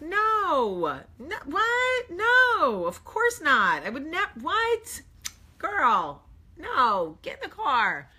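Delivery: loud, high, flustered protesting; overdone denial. Retold or imitated. Mom denies forgetting her daughter, flustered loud denial. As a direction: protest far too much, voice shooting up.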